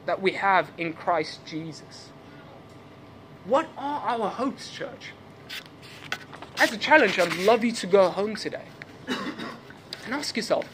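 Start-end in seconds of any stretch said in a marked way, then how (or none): none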